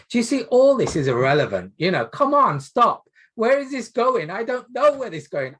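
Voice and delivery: rising exasperated rant, come on, stop; exasperated outburst. Real, not acted. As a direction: cry out in frustration at the pointless detail. Teacher's exasperated outburst at roleplay.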